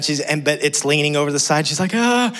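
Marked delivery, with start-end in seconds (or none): 1.9-2.3 high startled "ah"; a child's short scared cry; frightened yelp. Retold or imitated. Coaster leans over the side.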